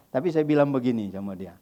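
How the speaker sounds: ordinary talk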